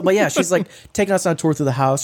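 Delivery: ordinary talk, nothing marked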